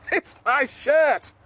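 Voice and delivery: squealed happily. Acted. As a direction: squeal with joy at finding it. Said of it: "Zippity squealed happily".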